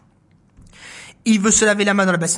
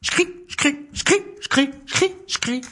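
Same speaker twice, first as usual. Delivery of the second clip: little mouse steps in the snow; tiny footsteps; 8 semitones higher, slower than usual. Acted. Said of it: "Une souris vient de passer par là".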